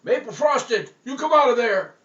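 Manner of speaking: booming exorcist cry; hollered. Acted. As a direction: drive the donut out. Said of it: "And he said".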